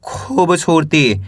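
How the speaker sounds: drawn out word by word; slow syllable reading. Shown as a demonstration. Teacher reads the word slowly for beginners. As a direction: sound out slowly for a child. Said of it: "कैसे पढ़ेंगे?".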